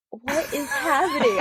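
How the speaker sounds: high, slow mock disbelief; drawn-out, laughing astonishment; surprised. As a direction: re-enact stunned surprise, half laughing. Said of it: "the most surprised I've ever been".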